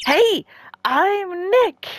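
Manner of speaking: drawn-out, high-pitched hail. Acted. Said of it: "Maybe I'm not saying it loud enough".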